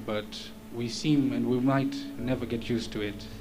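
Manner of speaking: ordinary delivery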